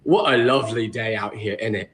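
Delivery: low British accent, imitated; casual British small talk, put on. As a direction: play a friendly British stranger. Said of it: "talk in that exact same accent".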